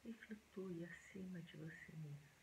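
soft, hushed meditation voice with long pauses; quiet, unhurried relaxation guidance; guided meditation. Acted.

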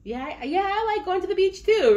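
sudden high, loud burst of delight about going to the beach too; light up at remembering you like the beach; excited realization. Pretend. Reader to viewers, an excited rediscovery of a liking. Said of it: "And you're like".

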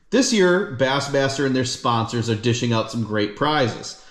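usual speaking voice

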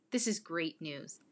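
ordinary delivery, nothing marked

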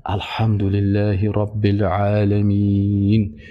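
slow, each word drawn out; slow measured recitation. Shown as a demonstration. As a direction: recite unhurried, pausing at the verse end. (تلاوت آرام و با ترتیل)

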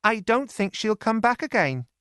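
put-on a young maid breaking news; Lizzie's voice. A narrator's reading. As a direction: servant girl telling sad news. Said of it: "said Lizzie".

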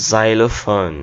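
"জাইলোফোন" starting with z, zai-lo-fon; correct pronunciation. Shown as a demonstration. Teacher revealing the fun word, correct pronunciation. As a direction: open "জাইলোফোন" on a buzzing z. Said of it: "সাউন্ডটা শোনাই".

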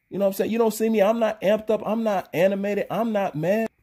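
ordinary delivery

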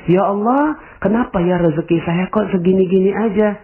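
high, pleading complaint to God; plaintive introspection. Acted. Person introspecting asks God why their provision is stuck, plaintive. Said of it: "seandainya Anda sekarang ini berintrospeksi".

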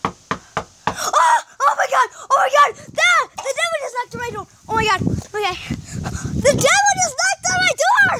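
high frantic panic; scared horror game streamer. Acted. Devil knocked on door.

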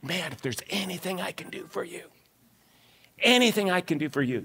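very soft, grateful offer; acted debtor's quiet, heartfelt pledge; hushed gratitude. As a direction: drop to a near murmur, as if overwhelmed. Second debtor voiced, overcome with gratitude.

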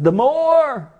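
high, drawn-out crying out; impassioned cry. Acted. Preacher telling of blind Bartimaeus, high impassioned cry. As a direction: raise the pitch and cry it out. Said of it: "he cried out".

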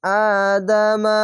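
আদামা chanted slowly, first vowel held; slow recitation of আদামা with long first vowel; one-alif stretch. Shown as a demonstration. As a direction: draw out the opening "aa" of আদামা. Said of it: "এক আলিফ টেনে পড়বেন".

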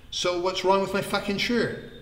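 mildly heated, offended question; indignant challenge. Acted. Vikram challenges his friend over the shirt, indignant.

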